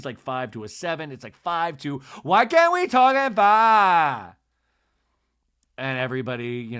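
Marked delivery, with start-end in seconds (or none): 2.2-4.4 much higher, louder, slow mock scream; imitating Lisa's scream. Imitating Lisa screaming at the women, mock scream. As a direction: shriek it, drawn out. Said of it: "goes from, like, a five to a ten".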